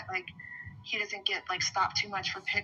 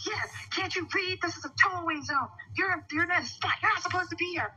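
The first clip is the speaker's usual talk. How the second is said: high-pitched mock yell of an aggressive man; imitated yelling; 8 semitones higher than usual. Retelling the man's parking complaint to an officer, imitated yelling. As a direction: act out someone snapping at her, pitched up. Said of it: "he was yelling at me".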